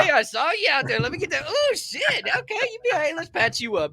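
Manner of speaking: high, mock-tender medic voice; playful field medic. Host playing a medic to his wounded co-host, mock tender. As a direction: pretend to patch up a wounded buddy.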